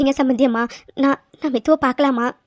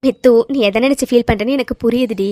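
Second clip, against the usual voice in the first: normal talking voice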